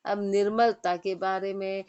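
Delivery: normal talking voice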